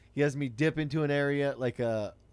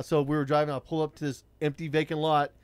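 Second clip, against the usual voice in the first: usual speaking voice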